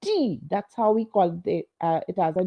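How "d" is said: "D" said very crisply, high and falling; crisp, demonstrative "D" with a falling tune; crisp British consonant. Shown as a demonstration. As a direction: hit "D" hard and crisp. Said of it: "very crisp".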